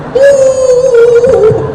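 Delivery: high, loud drawn-out mock sob; imitated wail. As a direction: imitate a man bawling loudly.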